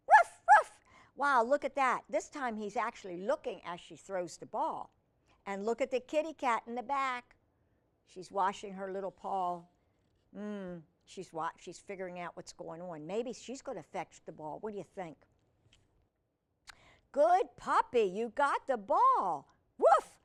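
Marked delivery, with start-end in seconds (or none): 0.1-0.7 two very high, loud puppy barks. Acted. 17.1-19.5 loud, raised, delighted praise; praising the puppy. Acted. 19.8-20.2 single high, loud yap; puppy bark. Acted. Puppy barks in story.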